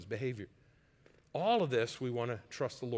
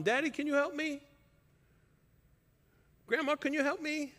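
put-on high little-child voice asking for help; imitating a child asking for help; childlike plea; 12 semitones higher than usual. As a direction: play a small child tugging at daddy. Small child asking parent for help.

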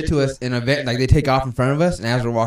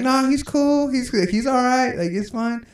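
high, softening voice; lightly voiced girl calming it down; mock easygoing woman; 12 semitones higher, slower than usual. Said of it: "she's like".

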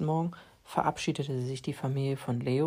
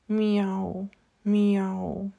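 higher mock meows, drawn out; tired meows; 5 semitones higher than usual. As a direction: meow with the last of his strength. Exhausted cat barely meows.

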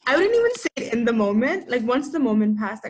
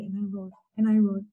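usual speaking voice

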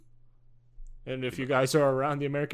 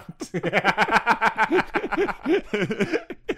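bursting out laughing; big real laugh at the slip; loud laugh; 6 semitones higher, 5 dB louder than usual. Real, not acted.

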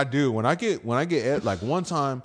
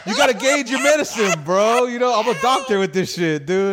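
loud, high bro boasting; frat bro; 10 semitones higher, 7 dB louder than usual. Retold or imitated. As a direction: hype yourself up, bro. Boasting about dosing.

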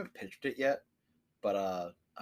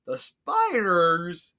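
much higher, louder, very slow; dopey slow question; 9 semitones higher, 9 dB louder, much slower than usual. Retold or imitated. Dopey investigator hears about spiders, slow and high. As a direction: draw it out like a delighted idiot. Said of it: "And he's like".

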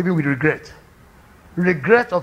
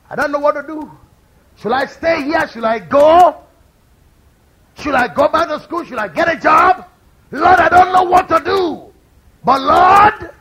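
high, loud pleading to the Lord; fervent prayer; 8 semitones higher, 6 dB louder than usual. Acted. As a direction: cry out to God in doubt. Prayer cried out in sermon.

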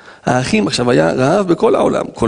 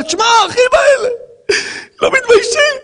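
mock crying. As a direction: fake sobbing complaint for atmosphere. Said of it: "אני לא באמת בוכה".

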